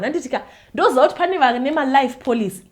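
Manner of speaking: ordinary delivery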